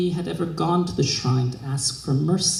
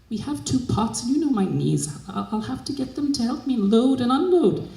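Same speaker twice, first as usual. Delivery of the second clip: higher, lightly voiced servant, polite and eager; a humble maid pleading her case, pitched up; servant asking her mistress; 8 semitones higher than usual.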